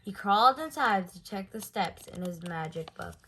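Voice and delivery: usual speaking voice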